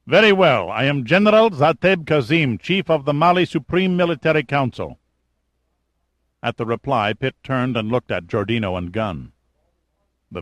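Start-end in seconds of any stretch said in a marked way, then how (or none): 0.1-5.0 commanding officer, higher and louder; a haughty military ruler on the radio; stern general voice. Acted. Kazim voiced over radio.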